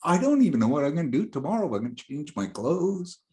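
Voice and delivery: high, fast, rushed nervous babble; flustered teen rattling off plans; panicked babble. Retold or imitated. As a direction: gabble it out in a panic.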